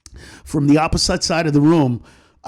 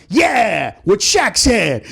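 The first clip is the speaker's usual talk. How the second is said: shouted agreement; 6 semitones higher, 3 dB louder, much slower than usual. Retold or imitated. Backing Shaq, shouted.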